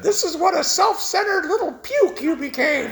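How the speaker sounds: a high, laughing little bullet scolding him; talking bullet. Acted. The bullet in his pocket scolds him, high and mocking. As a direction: give the bullet a snide, high voice. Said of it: "that little bullet said".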